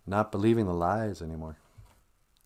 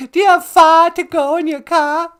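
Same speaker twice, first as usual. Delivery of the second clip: put-on high, loud Boston accent with dropped Rs; mock Boston accent; 12 dB louder than usual. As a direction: shout it in exaggerated Bostonian. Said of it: "sound like people from Boston".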